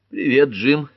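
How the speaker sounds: high, loud, lively hello; cheerful greeting. A narrator's reading. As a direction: greet him brightly and merrily. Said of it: "весело поздоровалась Пэт".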